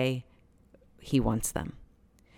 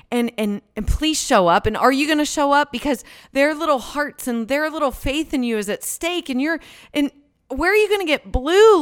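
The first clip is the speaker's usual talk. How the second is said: rising, louder, faster desperate plea; begging God to show up, building higher; pleading with God; 8 semitones higher, 6 dB louder than usual. Retold or imitated. Mom praying silently on the hike, building to a desperate plea. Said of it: "inside my heart, what I'm doing is going".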